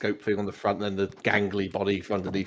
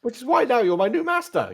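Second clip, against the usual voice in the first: high-pitched droid servant; Nim; 13 semitones higher, 3 dB louder than usual. Acted. Droid explains to new master, high droid voice. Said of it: "I'll call you Nim".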